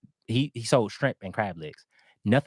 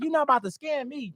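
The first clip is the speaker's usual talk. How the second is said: high-pitched mock demand; imitated angry attitude. Vendor demanding his food back from a customer, imitated indignation. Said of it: "the attitude he had".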